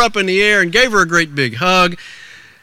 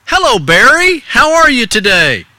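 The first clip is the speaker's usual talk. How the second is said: high, loud hearty greeting; storekeeper's raised, friendly call; store owner; 8 semitones higher, 5 dB louder than usual. Acted. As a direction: play the storekeeper hailing a young customer. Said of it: "between Mister Miller, the store owner".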